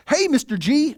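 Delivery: put-on high, bright-eyed kid calling hello; cheerful kid greeting. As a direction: greet the teacher like the happiest kid alive. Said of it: "he's like".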